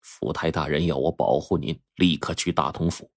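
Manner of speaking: soft, hushed secret message; low voice. A narrator's reading. Secret visitor to Wang Liqing, hushed and low. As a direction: speak low so no one overhears. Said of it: "这才低声说".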